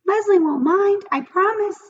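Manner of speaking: high, loud tempting little voice; pigtail voice. A narrator's reading. The pigtail coaxes Paul in a high little voice. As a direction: coax him like a tiny tempter.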